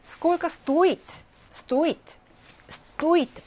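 стоит stressed on о, pronounced "STO-it". Shown as a demonstration. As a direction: punch the О in стоит, saying STO-it. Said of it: "오의 강세 있습니다".

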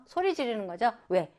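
ordinary talk, nothing marked

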